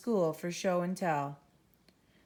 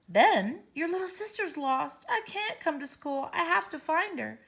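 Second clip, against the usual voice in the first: worried mother; 9 semitones higher than usual. Acted.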